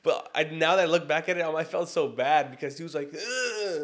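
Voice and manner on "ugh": high, strained groan as if unable to speak; wordless mimicked moan; imitated pleading groan. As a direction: mimic a paralyzed sleeper moaning for help. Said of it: "trying to get me to".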